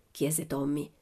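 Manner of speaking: ordinary delivery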